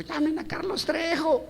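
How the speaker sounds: very high, stretched-out comic shriek; panicked yelling. Acted. Disciple joking about calling ghost hunters, high panicked yell. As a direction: cry out for help in mock panic. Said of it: "¿qué es lo que gritan?".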